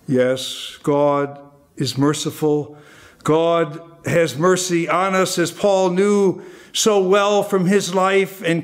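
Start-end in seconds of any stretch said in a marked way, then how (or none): none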